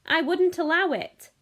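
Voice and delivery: high, loud protest; cried out. A narrator's reading. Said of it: "cried Mister Wonka".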